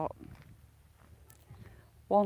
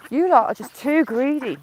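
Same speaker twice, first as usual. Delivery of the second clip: mock scolding; 4 semitones higher, 8 dB louder than usual. Real, not acted. Scolding the ducks.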